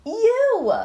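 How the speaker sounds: high, very loud burst; excited exclamation. Acted. Lollipop telling Mister Caper he'll be loved, excited shout. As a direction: pop the word out with delight.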